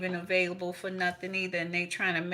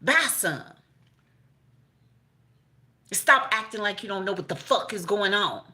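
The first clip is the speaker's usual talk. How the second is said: scolding grandma; 3 semitones higher, 5 dB louder than usual. Retold or imitated. Grandma scolding the family about buying tiaras, loud and sharp. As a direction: tell them off the way grandma would.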